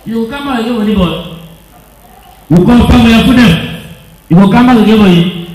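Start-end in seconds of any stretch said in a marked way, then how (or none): 2.5-5.5 louder commanding questions; preacher interrogating the spirit; loud demand. Real, not acted. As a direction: press the spirit hard.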